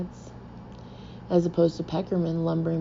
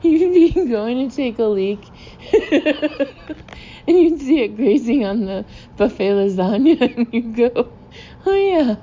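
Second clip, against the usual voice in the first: high, loud, real laughing fit while talking; helpless laughter; 7 semitones higher, 6 dB louder than usual. Real, not acted. Teller picturing an unsupervised horse at the wedding, cracking up. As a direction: barely get the words out for laughing.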